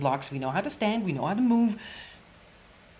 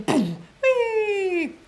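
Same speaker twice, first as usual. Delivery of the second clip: very high, loud squeal of glee; hammed-up burst of fake energy; squealing mock whee; mock whoop; 6 dB louder than usual. Mocking speaker's energy spike.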